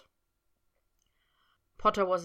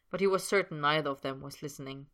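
ordinary delivery

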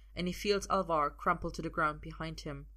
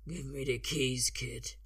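unfamiliar man's voice; 3 semitones lower, slower than usual. A narrator's reading. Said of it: "an unfamiliar voice says".

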